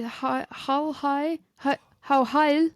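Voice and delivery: mock the eager lackey, dragging it out; a hammed-up grovelling minion; hammed-up servile squeak. Mocking the servile reply.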